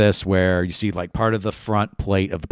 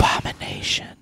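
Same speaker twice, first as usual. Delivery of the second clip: eerie whispered accusation; creepy whisper; 4 dB quieter than usual. Retold or imitated. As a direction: hiss it like a ghost child. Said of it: "her creepy child voice".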